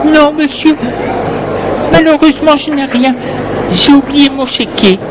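embarrassed. Acted. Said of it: "gênée".

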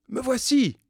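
high-pitched shouted answer; high cry from a distance; calling back. A narrator's reading. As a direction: call out so they can find you. Answers the search call.

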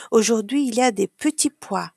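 plain reading voice

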